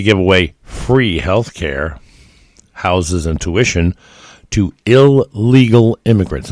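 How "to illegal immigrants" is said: illegal said higher, louder and slow; emphasis on illegal. Shown as a demonstration. Commentator stressing illegal to his audience, slow and loud. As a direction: lean hard on illegal, drawn out. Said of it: "And I emphasize the word illegal".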